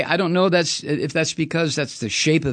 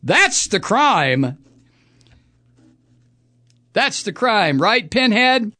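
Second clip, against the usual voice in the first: taunting; 6 semitones higher, 5 dB louder, slower than usual. Real, not acted. Taunting the chairman.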